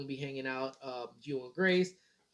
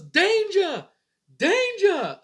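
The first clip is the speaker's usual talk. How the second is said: high, loud imitated alarm yell; mock yell; 11 dB louder, much slower than usual. Husband imitating wife yelling a warning about the baby, loud and high. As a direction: shout "Danger" like a frantic wife. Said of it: "Amy would be yelling".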